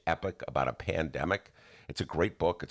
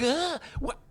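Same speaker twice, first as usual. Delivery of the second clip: much higher yelp of disbelief; startled shock. Retold or imitated. Seeing a man with a runny nose, a high startled squeak. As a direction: jolt back in alarm. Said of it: "I'm like".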